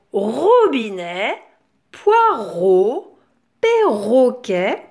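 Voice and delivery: "robinet, poireau, perroquet" slowly, syllables stretched; careful syllabifying. Shown as a demonstration. Teacher having children hunt for ro, "robinet, poireau, perroquet" syllabified. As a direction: emphasise each beat of "robinet, poireau, perroquet". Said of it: "où on entend la syllabe ro".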